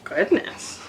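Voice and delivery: normal talking voice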